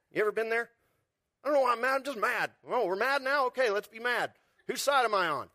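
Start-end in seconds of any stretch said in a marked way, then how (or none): 1.4-5.5 mock indignant. Voicing angry churchgoers.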